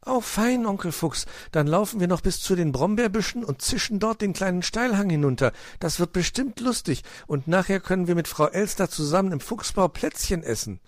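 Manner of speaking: high, excited little fox; cheering child's voice, high and lively; jubilant. A narrator's reading. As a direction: bubble over with childlike delight. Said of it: "jubelte Weißohr".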